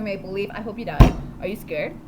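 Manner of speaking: usual speaking voice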